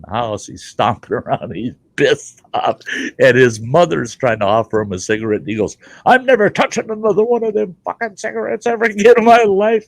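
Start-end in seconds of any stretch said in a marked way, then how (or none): 6.0-9.9 high, loud furious vow; imitating a furious friend; angry outburst. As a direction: rage it out like a man swearing off smoking. Retold angry outburst of Brian.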